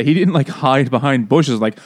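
normal talking voice

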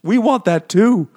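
high, pleading little pig voices, giggling through; begging pig people. Retold or imitated. Pig people beg Ender for space travel, high pleading voices. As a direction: whine like small creatures asking for the stars.

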